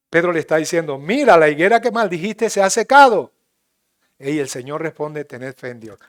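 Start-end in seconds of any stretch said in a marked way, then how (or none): 1.0-3.3 louder, higher cry of amazement; astonished exclaim. Acted. Peter marvels to his teacher, louder and higher. 5.2-6.0 low, soft, quiet answer; calm reply. Acted. Jesus calmly answers Peter, low and soft.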